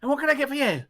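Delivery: high, sweet barmaid greeting; halfling barmaid. Acted. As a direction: coo it like a friendly barmaid. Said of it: "She turns and goes".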